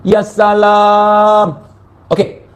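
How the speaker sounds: slow, stretched exclamation; drawn-out sigh of exasperation. Acted. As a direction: drag it out in disbelief. (seruan panjang yang lambat)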